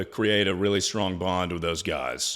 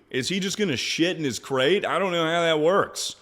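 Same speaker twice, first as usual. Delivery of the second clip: usual speaking voice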